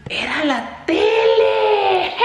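very high, drawn-out squeal; delighted squeal. Real, not acted. Vlogger realising the secret delivery was a TV, delighted squeal. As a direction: squeal the happy discovery, stretching it out.

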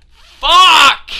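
loud, high, drawn-out curse; frustrated shout. Real, not acted. Player swearing at the game, frustrated shout. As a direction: yell the swear at full volume.

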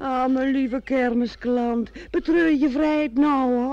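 loud teasing. Acted. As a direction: tease him grandly about lost freedom. Fiancée teases the hesitating groom. (luid plagend, hoog en traag)

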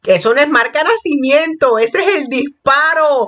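high, agitated outcry; conmocionada. Real, not acted. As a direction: cry out in shock, pitch way up. Shocked at gunshot scar, imitates shots. (exclamando espantada, con voz muy aguda)

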